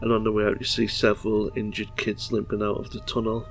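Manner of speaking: ordinary talk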